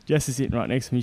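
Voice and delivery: normal talking voice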